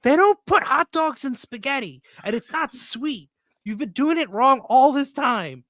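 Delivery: retold outrage, pitched high; high, indignant complaint, hammed up; mad kid. Kid home from a friend's dinner, mad at parents. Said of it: "came home so mad at my parents".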